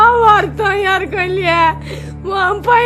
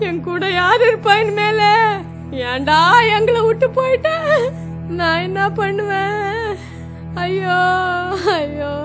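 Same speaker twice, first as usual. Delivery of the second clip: tearful keening over a lost son; wailing lament; 8 semitones higher, 10 dB louder than usual. Acted. Mother wails over her dead son, tearful lament. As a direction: mourn aloud, voice breaking now and then.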